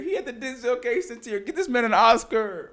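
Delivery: high-pitched gushing that builds loud; teasing mock awe. Real, not acted. Co-host hyping friend's single tear, gushing and teasing. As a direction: squeal over him like an award-worthy actor.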